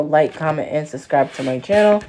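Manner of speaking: loud, upbeat channel intro; big, projected host greeting; video intro. Acted. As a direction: announce the video loudly to your viewers. Opens own video as host.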